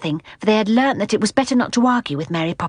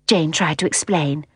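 plain reading voice

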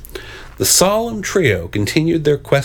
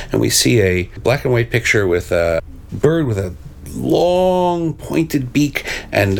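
ordinary delivery

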